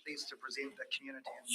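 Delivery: very soft, hard to hear; barely audible. Real, not acted. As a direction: speak so quietly the room strains. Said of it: "Can you speak up".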